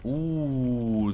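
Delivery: ordinary talk, nothing marked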